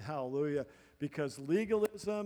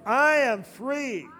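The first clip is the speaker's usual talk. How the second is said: fervent declaration; 4 semitones higher, 6 dB louder than usual. Acted. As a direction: declare each line like a promise. Leads congregation in declarations.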